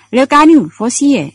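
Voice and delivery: strigă numele tare și mai sus; strigă după camarazi în noapte; strigă după oameni. A narrator's reading. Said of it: "Brigadierul strigă". (strigăt după camarazi)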